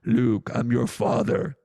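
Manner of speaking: slow and loud, hammed-up Darth Vader line; James Earl Jones. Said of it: "when you hear James Earl Jones say".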